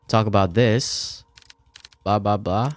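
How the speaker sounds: ordinary talk, nothing marked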